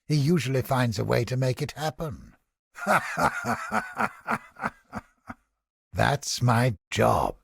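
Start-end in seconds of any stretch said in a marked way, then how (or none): 2.8-4.4 short laugh climbing up high; rising laugh. Acted. Mercenary laughing off talk of doom. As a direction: let a mocking laugh rise. Character barks in a game.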